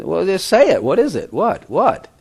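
husband imitating his wife's impatient prodding.

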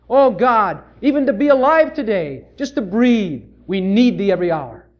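earnest, rising congregational prayer; fervent prayer for mercy. Acted. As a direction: lead the church in pleading prayer.

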